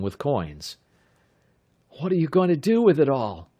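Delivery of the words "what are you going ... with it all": joking. A narrator's reading. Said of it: "Bud asked jokingly".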